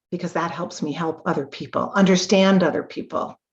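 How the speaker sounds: ordinary talk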